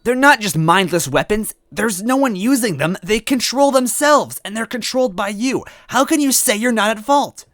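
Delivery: put-on high, loud girlish shout; Toadette shouting. Toadette shouting at the blacksmith Smithy. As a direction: shout the accusation as a small girl. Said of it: "Toadette shouted".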